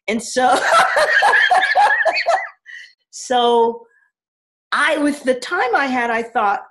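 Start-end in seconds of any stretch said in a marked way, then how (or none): 0.6-2.8 long rising laugh; delighted high laughter. Real, not acted. Laughing at the story.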